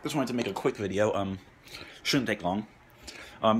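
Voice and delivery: usual speaking voice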